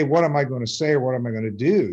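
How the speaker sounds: ordinary talk, nothing marked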